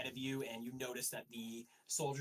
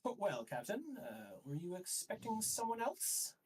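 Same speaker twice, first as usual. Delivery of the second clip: higher-pitched, mock-polite official; needling inquisitor; 8 semitones higher than usual. High Inquisitor to the surprised captain, higher needling voice. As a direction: needle the captain with feigned courtesy. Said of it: "he goes".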